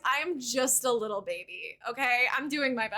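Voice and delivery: ordinary delivery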